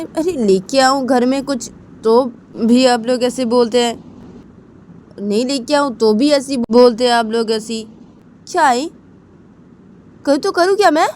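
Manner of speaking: snapping in frustration at mom; irritated son. Acted. Son's angry outburst to mother. (चिढ़े हुए बेटे की ऊँची, तेज़ आवाज़)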